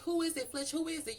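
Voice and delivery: high-pitched imitation of nosy coworker; mock busybody questioning; messy, nosy prodding. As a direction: pester for gossip up high. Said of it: "just being messy".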